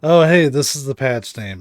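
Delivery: ordinary talk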